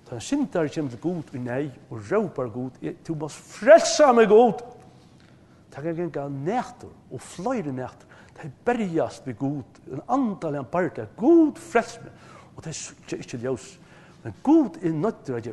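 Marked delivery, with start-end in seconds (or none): 3.1-4.5 loud, slow plea for rescue; desperate cry. Acted. Sinner cries out to God. 11.2-12.1 high, loud plea; desperate cry. Acted. Sinner cries out to God.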